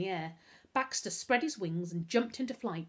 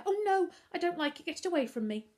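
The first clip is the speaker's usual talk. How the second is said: high, louder panicky protest; frightened recoil; 11 semitones higher, 6 dB louder, faster than usual. A narrator's reading. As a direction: beg for it to be taken away. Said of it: "Novak shrank back into the chair".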